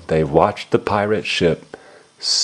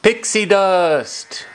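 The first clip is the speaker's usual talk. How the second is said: lightly voiced Peter Pan, higher and slow; drawn-out, higher hero call; 9 semitones higher, slower than usual. A narrator's reading. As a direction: call out the magic words as the boy hero. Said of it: "Peter Pan shouted".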